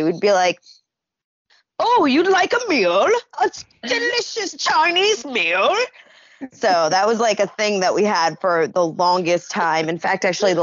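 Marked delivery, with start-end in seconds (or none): 1.8-5.7 high, hammed-up fake British accent; mock fake British accent. Friends greeting each other, mocking fake British accent.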